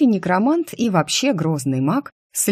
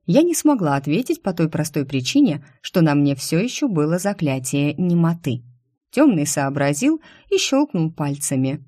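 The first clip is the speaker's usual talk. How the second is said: usual speaking voice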